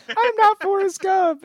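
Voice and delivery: very high, slow mock-Southern voice; Pam's Southern accent. Player denying she is Forrest Gump, high Southern accent. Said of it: "she's using a different Southern accent".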